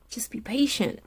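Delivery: breathy whisper, barely voiced; whispered reassurance. Real, not acted. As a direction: lean in and whisper it gently. Soft reassurance to the viewer.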